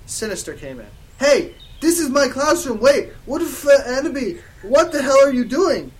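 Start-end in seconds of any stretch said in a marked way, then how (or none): 1.2-5.9 high, loud indignant outburst; outraged teacher. Acted. Professor Sinister finding students in her classroom, outraged. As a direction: burst in yelling, pitched up.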